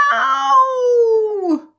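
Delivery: very high loud yelp; howl. Acted. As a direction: yelp in terror at the crocodile. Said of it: "howled Mister McGee".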